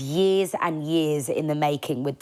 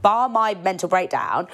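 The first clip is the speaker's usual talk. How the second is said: normal talking voice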